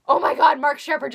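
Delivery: loud, high sudden squeal; real burst of fangirl excitement; loud and high, suddenly thrilled; excited outburst. Real, not acted. Sudden news mid-story.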